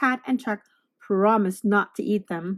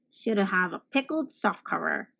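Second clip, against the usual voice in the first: a lower, softer monster mumble; quiet monster grumble; 4 semitones lower, 3 dB quieter than usual. Acted. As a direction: grumble like a sulking beast. Another monster head grumbling.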